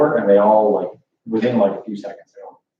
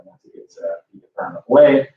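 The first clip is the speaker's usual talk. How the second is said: usual speaking voice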